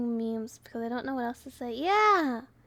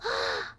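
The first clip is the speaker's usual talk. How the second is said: surprised gasp; 11 semitones higher than usual. Real, not acted. Gasp at uncle news.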